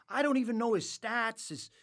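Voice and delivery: high, agitated objection; flustered protest. Retold or imitated. MC to Gretz about not knowing the stats, flustered. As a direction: protest as if completely unprepared.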